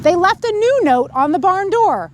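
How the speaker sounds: put-on lively read-aloud to children; storybook reading. A narrator's reading. Reader telling children the farm story, lively read-aloud. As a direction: tell it like a bedtime story. Reads picture book to kids.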